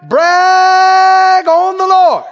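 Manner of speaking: loud, high, hugely stretched proclamation; exultant preaching cry. Acted. Preacher urging the church to boast on God, exultant cry. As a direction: hold the first word long and ring it out.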